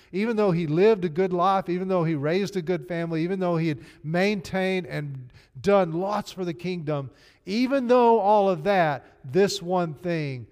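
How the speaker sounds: loud, rising preacher's list; preaching at full force. Real, not acted. Preacher driving home a healed man's worth, loud.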